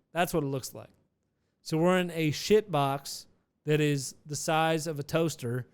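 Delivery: normal talking voice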